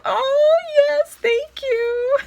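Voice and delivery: very high, slow, emotional oh my gosh; overjoyed squeal. Real, not acted. Vlogger overwhelmed by a gift from her friend Tara, high squeal. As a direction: squeal with happy tears close. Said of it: "I'm all up in my emotions today".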